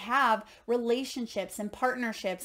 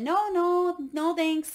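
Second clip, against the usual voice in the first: higher, louder mock brush-off; breezy refusal; 6 semitones higher, 4 dB louder, slower than usual. Man on tower to helicopter crew, breezy refusal. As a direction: lightly act out turning help down, pitched up.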